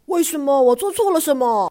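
loud, startled question from dad; taken aback and raising his voice; surprised. Acted. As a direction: react as if caught off guard. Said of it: "爸爸很惊讶".

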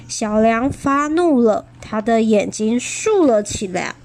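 usual speaking voice

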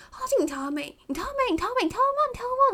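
high, loud repeated demands; fierce nagging. Retold or imitated. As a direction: hammer the question at her, fiercer each time. Boyfriend nagging about rear mirrors.

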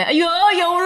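high, loud crying whine; hammed-up tearful cry; mock sobbing wail. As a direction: wail it like she's still weeping. Recalling waking up still crying.

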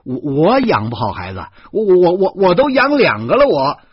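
loud, high, stuttering protest; indignant outburst. A narrator's reading. Husband accused of bad parenting, indignant shouting. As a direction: shout back, tripping over the first word.

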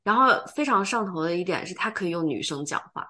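ordinary delivery

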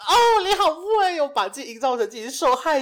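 laughing, squealing sarcasm at the friend; mock-impressed teasing. Real, not acted. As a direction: gush over her like she's a manipulative genius. Host teases with exaggerated voice.

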